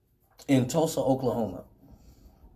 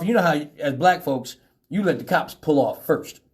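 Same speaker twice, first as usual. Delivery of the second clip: ordinary talk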